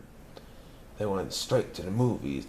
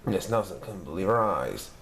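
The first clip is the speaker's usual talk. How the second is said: usual speaking voice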